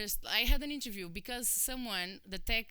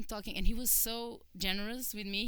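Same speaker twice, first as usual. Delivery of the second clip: ordinary delivery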